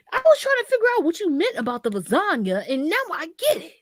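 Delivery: squeaky rushed impression of mom catching on; imitating mom.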